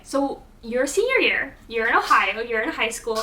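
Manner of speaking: ordinary delivery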